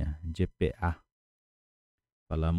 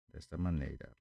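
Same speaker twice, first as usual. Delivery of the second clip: ordinary talk, nothing marked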